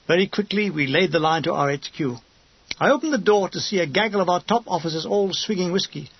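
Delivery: plain reading voice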